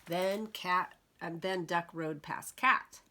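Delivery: ordinary delivery, nothing marked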